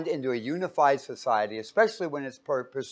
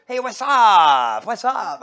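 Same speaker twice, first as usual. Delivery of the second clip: put-on loud, drawn-out street-accent holler; exaggerated street-accent greeting, stretched way out; picked-up street accent; 7 semitones higher, 12 dB louder, much slower than usual. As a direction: mimic a relative's new street accent, high and booming. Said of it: "that's not how you talk".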